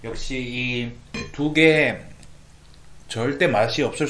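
chewing while speaking; words muffled by food; talking with mouth full. Real, not acted. Seen 2: Spoon to mouth at -2; cheeks bulge, chewing while speaking.

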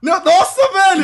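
high, loud outburst of shock; loud startled exclamation; startled shout. Real, not acted. Startled outburst during the hunt.

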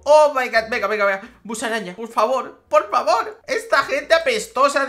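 loud, high, frantic begging; excited pleading. Real, not acted. Gamer willing the pet trade to go through, loud high pleading. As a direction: beg the game to work, shouting.

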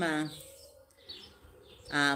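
ordinary talk

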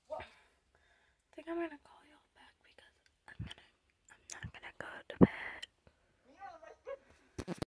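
very soft, hurried whisper; hushed whisper. Real, not acted. Vlogger signing off to viewers late at night, whispering. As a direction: whisper it secretively to the camera.

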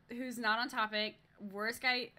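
ordinary delivery

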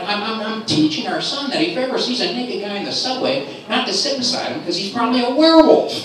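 high-pitched, stammering justification; flustered defence. Acted. Husband explaining himself to his wife, high flustered defence.

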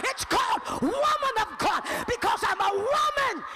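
high, shouted sermon climax; full-force preaching. Real, not acted. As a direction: cry it out to the congregation.

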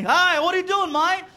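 high-pitched, puzzled Aussie call; Australian security guard. Retold or imitated. Security guard questions a shoeless traveler, high and puzzled. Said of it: "security looks at me".

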